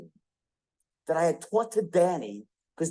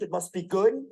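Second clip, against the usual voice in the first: ordinary delivery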